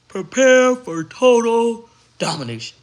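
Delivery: slow, booming proclamation; announcer boom. Acted. Hyping herself to viewers like a trailer announcer.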